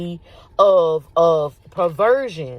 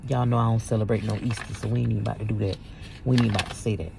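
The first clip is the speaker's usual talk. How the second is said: muttered aside; 8 semitones lower, 7 dB quieter, much faster than usual. Real, not acted. Quiet aside while searching.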